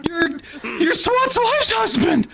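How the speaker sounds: very high, slow, cracking shout of disbelief; shocked groom yell. Retold or imitated. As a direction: squeal it out, stretched and high.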